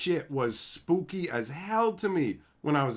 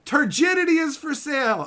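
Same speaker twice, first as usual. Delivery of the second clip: Turgidity is for sale proclaimed higher and louder; loud proclamation; 10 semitones higher, 6 dB louder than usual. Acted. Streamer to chat, repeating it as a loud proclamation. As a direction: announce it like a town crier. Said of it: "You heard it here first".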